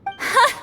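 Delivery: amused laugh. Acted. Priestess laughs at the boy.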